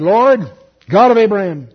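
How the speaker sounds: very loud, declaimed invocation; calling on God. Acted. Elijah praying before all the people, loud invocation. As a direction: cry out to heaven. Prophet calls on God in prayer.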